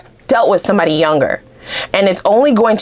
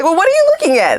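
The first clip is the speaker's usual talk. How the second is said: teasing question; 12 semitones higher, faster than usual. Pretend. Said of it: "You're like".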